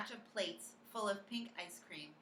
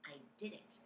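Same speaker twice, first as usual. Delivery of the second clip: whispering. A narrator's reading. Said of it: "I whispered".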